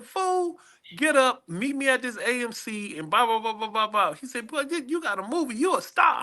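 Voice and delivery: high, bossy mock woman voice; CEO ordering him. CEO ordering him to the premiere, high mock scolding.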